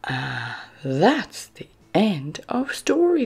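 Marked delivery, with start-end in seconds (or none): none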